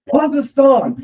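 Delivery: put-on "Pause the song" pushed up high and loud like an annoyed kid; a dad imitating his daughter's angry shushing, high and loud; imitated angry daughter. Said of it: "really angry, aggressive".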